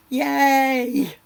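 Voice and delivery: loud, high happy yay; excited cheer. Real, not acted. Celebrating a buyer's earnest money.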